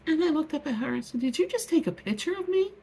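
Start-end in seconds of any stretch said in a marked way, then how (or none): 1.2-2.7 retold confrontation. Confronting woman taking her photo.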